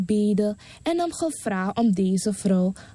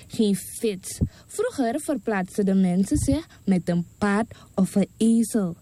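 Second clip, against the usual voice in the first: ordinary delivery, nothing marked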